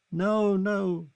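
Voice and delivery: high, loud cry of refusal. Acted. Kitty crying out no to Charlie's kiss. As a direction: cry out no as he kisses. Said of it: "she cried".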